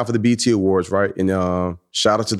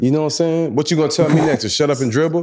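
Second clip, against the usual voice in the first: high, mock-offended protest; mock indignant; 6 semitones higher than usual. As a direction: play the offended activist, higher.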